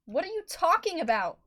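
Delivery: alarmed cry of confusion; whined. Acted. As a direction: plead in disbelief. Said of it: "she whined".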